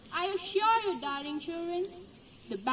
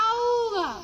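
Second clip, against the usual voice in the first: honking bagpipe squawk; 5 semitones higher, 5 dB louder than usual. Acted. Bagpipe squeezed, lets out a honk.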